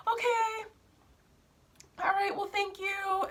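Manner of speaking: very high, small-voiced mock retreat; saleswoman impression. Twins freeze, then politely retreat.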